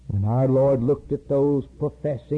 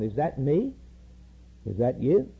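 ordinary talk, nothing marked

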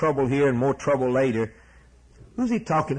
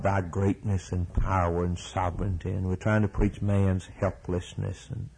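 prayer; 6 semitones lower than usual. Acted. Preacher leads a prayer.

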